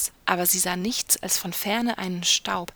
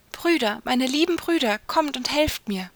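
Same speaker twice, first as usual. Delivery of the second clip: high, narrated cry for help; calling out loud; 5 semitones higher, 3 dB louder than usual. A narrator's reading. Sister calling brothers from the window, high urgent cry. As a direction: call urgently to someone far away. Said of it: "rief, so laut sie konnte".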